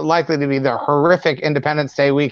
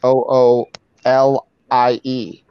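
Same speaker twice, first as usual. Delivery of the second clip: slow spelling; slower than usual. Shown as a demonstration. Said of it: "spelling the name".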